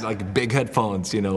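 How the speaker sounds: usual speaking voice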